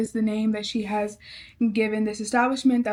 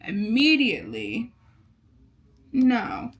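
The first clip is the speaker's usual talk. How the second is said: flat refusal; slower than usual. Retold or imitated. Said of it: "I was just like".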